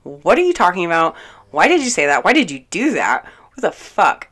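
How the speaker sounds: loud, incredulous questions fired at someone; indignant confrontation. Retold or imitated. Confronting a friend over what he did, indignant and loud. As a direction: retell it as a heated demand for answers.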